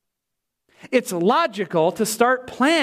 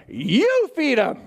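long, swooping stress on YOU; hammed-up retort leaning hard on "you"; emphatic comeback; 6 semitones higher, much slower than usual. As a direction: draw out "you" high, then land "feed 'em". Jesus's emphatic comeback, acted out.

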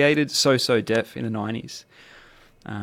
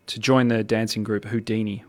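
usual speaking voice

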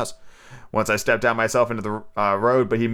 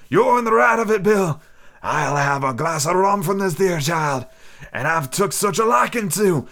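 put-on higher, slow old sea dog; sly pirate; 5 semitones higher, slower than usual. Stranger toasting his mate Bill, slow pirate voice. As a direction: drawl it like a scheming sailor.

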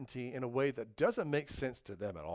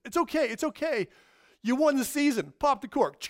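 excited reassurance; 9 semitones higher, 6 dB louder than usual. Retold or imitated. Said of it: "person shows up".

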